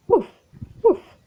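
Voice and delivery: high yappy mock bark; playful woof. Narrator ending the story with a dog's bark, high yappy woof. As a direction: bark like a small happy dog.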